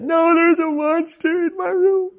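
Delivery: put-on high, whiny toddler protest; crying little boy. Son refuses dad's order back to bed, mock crying. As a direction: imitate a tearful child insisting, very high-pitched. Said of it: "he's crying, and he's like".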